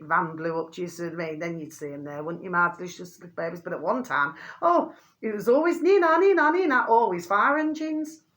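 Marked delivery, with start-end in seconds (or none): 5.8-6.8 siren imitation. Imitating old fire engine sirens.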